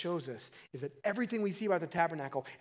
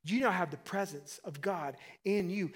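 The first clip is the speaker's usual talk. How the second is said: usual speaking voice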